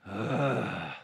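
a low, fed-up groan; low weary groan. Retold or imitated. Pastor imitating Jesus' groan at arrogant questioners, low groan. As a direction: groan like you've had enough. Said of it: "And so Jesus just groans".

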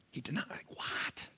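hushed, incredulous stage whisper; shocked whisper. Acted. Preacher stunned at Peter's denial, shocked whisper. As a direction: whisper it in mock disbelief.

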